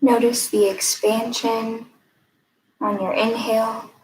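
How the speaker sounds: calm, even guiding voice with long pauses; guided meditation. Acted. Mindfulness fellow leading a breathing exercise, guided meditation. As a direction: lead listeners gently inward, leaving room between phrases.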